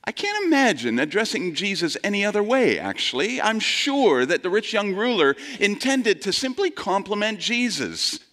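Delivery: usual speaking voice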